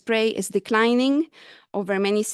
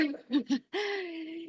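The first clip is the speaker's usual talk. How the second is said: breathless laugh; 8 semitones higher, 9 dB quieter than usual. Real, not acted. Embarrassed after running out of breath.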